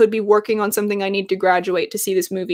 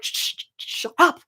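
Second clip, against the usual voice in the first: hushed, unvoiced shushing of a baby; mock whisper; 6 semitones higher, 5 dB quieter, slower than usual. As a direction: whisper it like hushing a crying infant. Parent hushing the baby.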